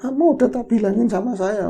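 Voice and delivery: lightly voiced old one scolding; very old speaker. Old being warning the men they were told, elderly voice. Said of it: "ngomong tua banget seperti itulah ya".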